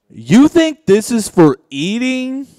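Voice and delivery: spring it on him, high and loud; high loud drawn-out mock-sinister question; creepy retort. Punchline retort to employee.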